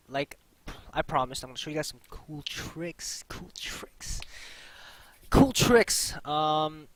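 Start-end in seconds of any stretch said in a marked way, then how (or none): 1.4-3.2 soft unvoiced whisper. Teasing cool tricks in whisper. 3.3-4.2 soft unvoiced whisper. Whispered echo of the phrase. 5.3-6.1 loud, high, excited announcement; loud hype. Acted. Loud payoff after whisper.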